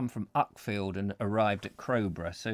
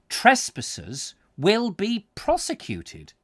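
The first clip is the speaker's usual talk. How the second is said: official notice; 7 semitones higher, 4 dB louder, slower than usual. Shown as a demonstration. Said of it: "Oh, persecuted".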